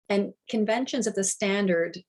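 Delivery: usual speaking voice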